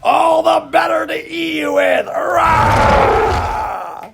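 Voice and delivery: loud wolf roar. Acted. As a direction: roar and pounce. Wolf pounces on the girl.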